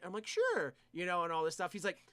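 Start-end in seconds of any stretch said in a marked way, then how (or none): 0.3-0.7 high fake sure. Humoring the customer.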